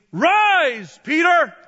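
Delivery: loud, high booming command; commanding voice from heaven. Acted. God calling Peter in his trance, loud commanding shout. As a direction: call out the order from heaven. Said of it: "a voice came to him saying".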